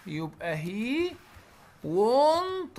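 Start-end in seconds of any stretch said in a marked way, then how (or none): 1.8-2.8 "want" said much higher and louder; stressed want. Shown as a demonstration. Teacher stressing "want" for the singular. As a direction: hit "want" hard to show the missing s. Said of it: "وبما انه مفرد اروح مزود الايه؟".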